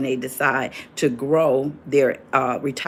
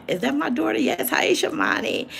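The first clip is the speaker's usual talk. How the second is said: delighted greeting; 13 semitones higher than usual. Real, not acted. Spots her daughter in chat.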